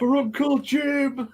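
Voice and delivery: high-pitched, drawn-out cry; comic strained holler; mock battle cry. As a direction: stretch it out like a hammed-up war cry. Acting out the screamed battle cry.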